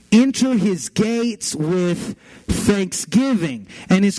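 slow, ringing declamation of a psalm; emphatic preacher reading verse, word by word; scripture reading. Acted. Reads scripture aloud emphatically.

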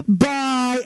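put-on high, drawn-out little-kid bye; imitated toddler farewell. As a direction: play a cute toddler signing off. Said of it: "And then he goes".

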